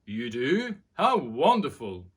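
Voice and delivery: loud, pleased royal father; delighted king. Acted. The king answering his son, loudly delighted. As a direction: beam it out proudly. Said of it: "says the king".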